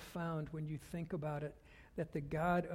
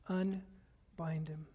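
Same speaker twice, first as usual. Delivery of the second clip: very slow, with a pause inside "Unbind him"; slow, weighty command; much slower than usual. Acted. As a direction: draw out each word of "Unbind him" deliberately. Preacher repeats the command for weight.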